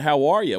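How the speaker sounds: "How are ya?" run together as the name Hawaii; grandmother's Hawaii. Retold or imitated. Grandmother walking around talking about her trip, "How are ya?" for Hawaii. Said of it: "which was Hawaii".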